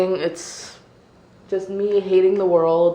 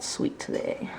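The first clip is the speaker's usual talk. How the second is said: whispered; 14 dB quieter than usual. Real, not acted. Savoring a sweet smoothie.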